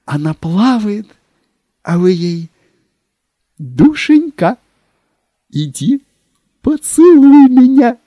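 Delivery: high, loud, rising rapture; rapturous. Acted. Secretary dreamily escalating.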